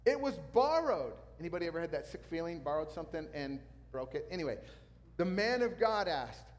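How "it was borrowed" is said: high, loud cry of dismay; raised, anguished storytelling shout; acted crying out. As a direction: cry out in alarm over the loss. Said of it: "he cried out".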